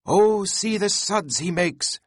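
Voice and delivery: high, slow, thrilled exclamation; excited cry. A narrator's reading. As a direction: bounce with glee as he cries out. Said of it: "cried Flask, dancing up and down".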